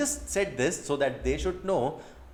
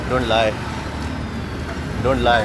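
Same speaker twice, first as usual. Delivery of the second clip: loud playful scolding, lower; loud teasing don't lie; 4 semitones lower, 8 dB louder than usual. Real, not acted. To the girl who won't sing, a loud playful don't lie. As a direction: tease her loudly not to lie.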